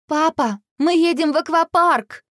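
high-pitched indignant protest. A narrator's reading. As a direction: protest to dad, outraged. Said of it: "возмутилась та". (возмущённо)

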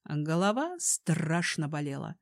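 ordinary delivery, nothing marked

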